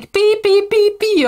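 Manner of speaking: high-pitched imitation of screaming; mock screaming. Streamer explaining the cat caller's word, mock screaming. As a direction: squeal it out as a pretend scream. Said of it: "he was actually just screaming".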